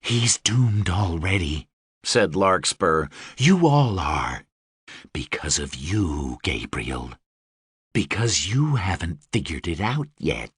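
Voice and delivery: low, smooth and seductive; sultry Deva voice. Acted. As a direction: purr it like a dangerous winged queen. Deva character voice.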